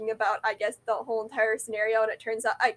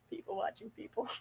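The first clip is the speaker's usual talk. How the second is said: breathy hushed whisper of the phrase; 14 dB quieter than usual. Shown as a demonstration. Repeating her phrase to herself in a whisper. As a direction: whisper it like a secret joke.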